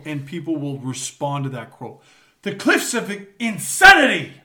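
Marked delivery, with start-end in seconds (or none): 2.4-4.3 mock shout. Throwing out a famous quote.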